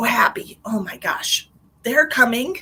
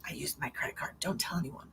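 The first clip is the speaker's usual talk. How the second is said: whispered confession, much softer; secret whisper; 7 semitones lower, 12 dB quieter than usual. Real, not acted. Confessing credit card use.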